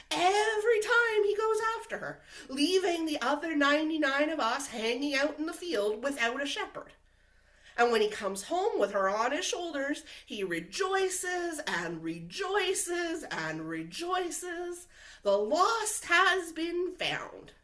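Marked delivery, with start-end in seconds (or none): 0.1-5.4 high-pitched indignant complaint; exasperated. Acted. Sheep complaining the shepherd always chases Hannah, exasperated. 10.3-17.5 slow, drawn-out, higher-pitched proclamation; mock-grand. Jealous sheep describing the shepherd's celebration, mock-grand.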